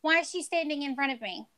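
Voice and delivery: high-pitched incredulous questions; acted indignation. Co-host playing herself behind a line-cutter, high mock indignation. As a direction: play up the affront, pitched well up.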